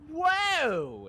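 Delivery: high, loud, drawn-out whoop; super excited. Retold or imitated. Man finally with the girl, super excited whoop. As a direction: let it burst out high and long. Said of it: "And I was super excited".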